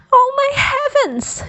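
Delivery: high, loud, shocked mother; high-pitched startled mother crying out; alarmed mother's cry. A narrator's reading. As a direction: gasp it out like a panicked mom. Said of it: "she cried".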